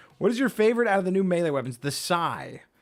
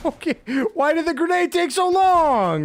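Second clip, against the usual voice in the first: loud frantic questions; flustered and alarmed; panicking gamer; 8 semitones higher, 4 dB louder than usual. Real, not acted. Grenade fails in game.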